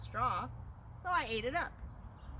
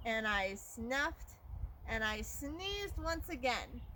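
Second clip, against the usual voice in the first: drawn-out build-up; slower than usual. Acted. Third huff and sneeze.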